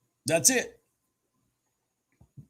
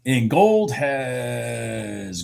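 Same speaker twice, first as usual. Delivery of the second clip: sleepy drawl; much slower than usual. Real, not acted. Sleepy market description.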